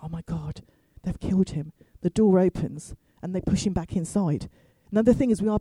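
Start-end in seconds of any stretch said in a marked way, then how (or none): 0.0-1.7 low, soft horrified thought; retold dread. Niece hears a thud outside, low hushed retold dread. Believes uncle was just killed.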